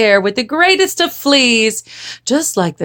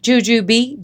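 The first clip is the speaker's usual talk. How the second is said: plain reading voice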